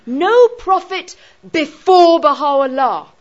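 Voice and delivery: loud, slow "No" and weighty "before"; stress on No and before. Shown as a demonstration. Teacher showing where the weight falls, stress on "No" and "before". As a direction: hit "No", then lean hard on "before". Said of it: "that's where it puts the emphasis".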